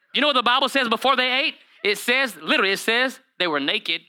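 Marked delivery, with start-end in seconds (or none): none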